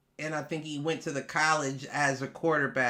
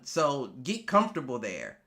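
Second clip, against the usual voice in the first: ordinary delivery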